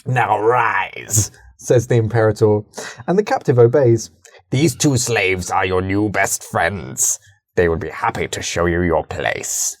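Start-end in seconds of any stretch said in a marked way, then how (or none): 0.1-1.3 lightly voiced commanding tyrant; a hint of a smug ruler giving orders; Imperator Stephen voice. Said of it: "my Imperator Stephen voice". 4.5-9.8 low, slow, soft menacing villain; Imperator Stephen voice. Acted. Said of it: "my Imperator Stephen voice".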